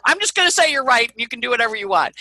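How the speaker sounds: high, loud, rushed mock surrender; imitating someone loudly caving mid-argument; exasperated concession. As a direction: throw up your hands and give in, fast. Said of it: "That's her posture".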